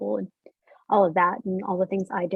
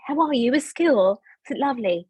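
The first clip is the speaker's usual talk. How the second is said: higher, playful British small talk; British accent; 3 semitones higher, 4 dB louder than usual. Retold or imitated. Mother asking daughter about school, British accent. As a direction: put on a chirpy British accent. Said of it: "you do a British accent very well".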